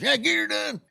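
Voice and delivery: catchphrase tossed off high and light; a fan's quick, high Get Her Done; imitating a fan. Fan at the record store says it lightly and high. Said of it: "He goes".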